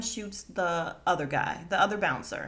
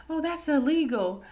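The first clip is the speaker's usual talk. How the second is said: mock shock; 7 semitones higher, 6 dB louder than usual. Shocked girl in retold scene.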